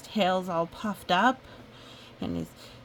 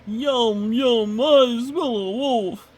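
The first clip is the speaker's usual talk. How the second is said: higher, louder slow monster chant; 4 semitones higher, 8 dB louder, slower than usual. Acted. Goose quoting the monster Wem, higher, loud and slow. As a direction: relish it like a hungry beast. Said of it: "wander around saying".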